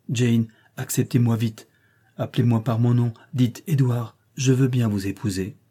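ordinary delivery, nothing marked

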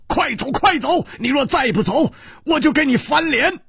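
high-pitched, frantic urging; urgent dismissal. Acted. A swordsman waves his friend away, threatening, frantic and high. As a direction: drive them away with a high urgent cry. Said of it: "一点红只是挥着手说".

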